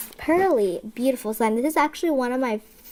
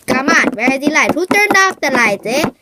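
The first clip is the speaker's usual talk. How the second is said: loud startled complaint; 3 semitones higher, 7 dB louder than usual. Real, not acted. Lights turned off.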